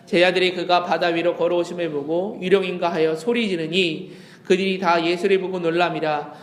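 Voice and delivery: usual speaking voice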